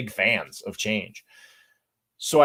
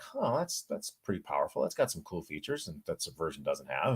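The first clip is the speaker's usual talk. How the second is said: quietly impressed; 5 semitones lower, 11 dB quieter than usual. Retold or imitated. Retold reaction of dawning interest.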